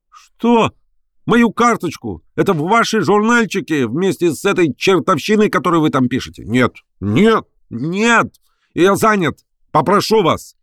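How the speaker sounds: high-pitched exasperated protest; outraged refusal. A narrator's reading. Professor throwing out a reporter, outraged and high. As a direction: refuse in mounting high indignation.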